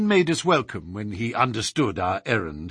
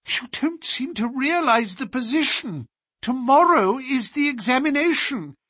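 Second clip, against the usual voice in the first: much higher, alarmed pleading tutor; astonished dismay; 11 semitones higher than usual. Acted. Tutor begging Holmes not to leave him before the exam, dismayed. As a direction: cry out in dismay at being left alone. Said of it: "cried aloud in his astonishment and dismay".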